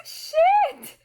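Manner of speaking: high, sharp shocked cry; shocked yelp. Real, not acted. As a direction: blurt it out in shock. Shocked exclamation.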